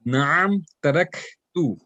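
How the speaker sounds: very slow "taraktu" with airy K before "tu"; hams on kaf. Shown as a demonstration. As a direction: stretch "Naam", let the K in "taraktu" flow. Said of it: "whenever you are saying it with the sukoon".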